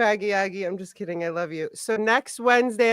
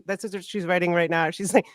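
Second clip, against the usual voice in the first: normal talking voice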